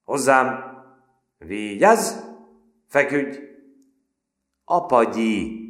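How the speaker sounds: loud, high barked orders; shouted commands. Acted. Cadets ordering the silent recruit about, loud barks. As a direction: drill him with shouted commands.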